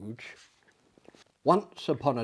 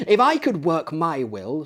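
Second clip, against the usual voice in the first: louder, higher indignant outburst; 5 semitones higher, 7 dB louder than usual. A narrator's reading. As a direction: bristle with outrage at the idea. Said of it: "said Scrooge indignantly".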